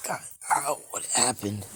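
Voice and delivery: hurt and bewildered; pained groan. Acted. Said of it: "he's groaning at the wall".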